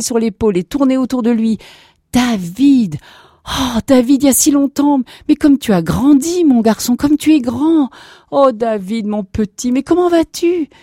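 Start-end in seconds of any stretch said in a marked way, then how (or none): 2.1-10.7 high, loud gushing welcome; overjoyed exclamations. Acted. Grandparents embracing their long-lost grandson, overjoyed.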